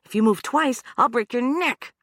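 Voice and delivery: high, loud, stressing "twice" and "neck"; escalating threat. Acted. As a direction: raise the stakes.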